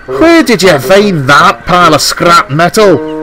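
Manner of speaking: high, slow outraged cry; indignant shout. A narrator's reading. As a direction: shout it in disgusted disbelief. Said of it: "they shouted".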